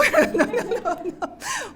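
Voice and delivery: high, put-on laughter; forced laugh. Wife making herself laugh before apologizing, forced laugh. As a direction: squeeze out a laugh that will not come. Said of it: "안 나오는 웃음을 억지로 웃으면서".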